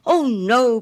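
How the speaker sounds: high, loud eager cry; a sudden cry of protest. A narrator's reading. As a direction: blurt it out in alarm. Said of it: "cried little Hans".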